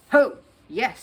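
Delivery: loud, sarcastic "oh, yes"; disbelieving. Acted. Gatekeeper mocking the bear's claim, loud and sarcastic. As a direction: scoff at the bear's story. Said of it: "said the gatekeeper disbelievingly".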